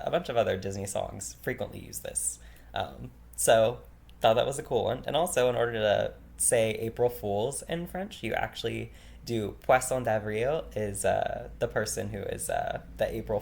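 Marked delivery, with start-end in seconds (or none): none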